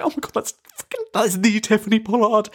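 high-pitched starstruck panic; mock freak-out at spotting an idol. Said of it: "I was freaking out".

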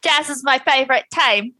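high attempt at Australian; Aussie accent pitched a little too high. Shown as a demonstration. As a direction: try Australian, overshooting high. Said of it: "You were kind of".

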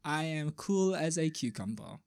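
feigned serenity, quiet and controlled; mock calm. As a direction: insist softly that you're perfectly composed. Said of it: "I am a calm, collected human being".

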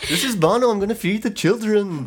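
a hammed-up [name] accent that slips toward Indian. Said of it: "I forgot my [name] accent".

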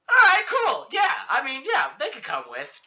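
high, loud, eager show-off agreement; peacocking. Retold or imitated. Agreeing to give her friends rides, peacocking. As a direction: puff up and agree a bit too keenly. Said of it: "I'm kinda peacocking".

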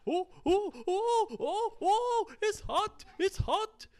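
very high, yelping panic over the heat; hammed-up cries of a man roasting; panicked. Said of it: "he was going".

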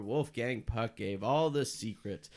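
usual speaking voice

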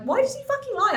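very high-pitched incredulous outburst; retold indignation. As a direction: squeak it in baffled outrage. Retells own indignation at the lie.

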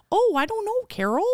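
put-on very high, lilting Minnesota-style accent; hammed-up high Minnesota voice; middle-aged Midwestern women. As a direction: parody a chatty Upper Midwest lady. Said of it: "like, middle-aged women go".

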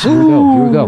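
high, loud hype call; hyped shout. Real, not acted. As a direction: fire up the crew. Hyped show start.

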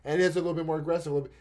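ordinary talk

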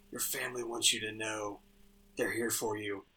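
low, soft, close to the ear; confidential murmur. Acted. Rapunzel hugging a guest close to slip in a secret warning, hushed. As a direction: keep it just quiet enough for one listener. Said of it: "it's just quiet enough".

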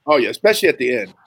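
ordinary talk

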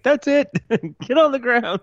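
high, laughing mock yell; mock cop shout. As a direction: playfully bark like a cop.

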